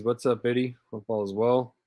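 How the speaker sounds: ordinary talk, nothing marked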